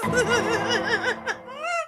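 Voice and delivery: high cackle; helpless high-pitched giggles; laughing. Real, not acted. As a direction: burst out laughing at the joke. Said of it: "I'm not laughing about that".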